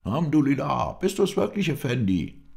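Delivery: high, loud, joyful exclamation; delighted, relieved shout, pitched right up; cry of joy. A narrator's reading. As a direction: call out overjoyed at a friend's return. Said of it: "stieß einen Ruf der Freude aus".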